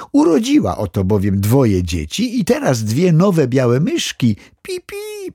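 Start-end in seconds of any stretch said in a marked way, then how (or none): 4.6-5.3 tiny, very high squeak; soft, piping little mouse voice; squeaking baby mice. A narrator's reading. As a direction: imitate newborn mice peeping. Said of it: "popiskując".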